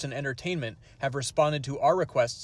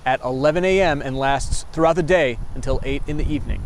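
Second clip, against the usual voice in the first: loud live sign-off on location; field reporter; 8 dB louder than usual. Acted. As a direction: project it like a TV reporter.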